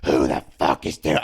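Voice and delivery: strained mock scream up from the floor; retold scream. Beaten wrestler on the floor to his attackers, mock screaming. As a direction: imitate screaming up at your attackers. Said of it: "I'm fucking screaming up at those motherfuckers".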